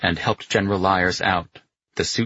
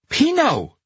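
high, loud name call; shouting; 4 dB louder than usual. A narrator's reading. Carletto calling the stunned Pino, shouting. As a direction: shout to get his attention, pitched way up. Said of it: "Carletto shouted".

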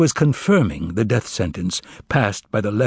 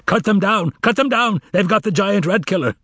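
battle command shout; 10 semitones higher than usual. A narrator's reading. Pilate yells to his cavalrymen in battle, commanding shout. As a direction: scream the charge order across the field. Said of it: "Pilate had shouted to his cavalrymen in the same voice".

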